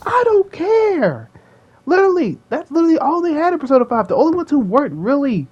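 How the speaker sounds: high-pitched, exasperated outburst; defensive whine at the critics. Real, not acted. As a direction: protest in a stretched, very high voice. Arguing back at imagined critics.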